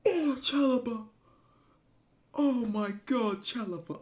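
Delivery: real overjoyed fan shriek; ecstatic goal scream. Real, not acted. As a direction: lose it completely as the goal goes in.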